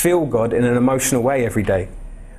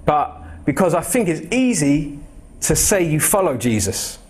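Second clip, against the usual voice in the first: usual speaking voice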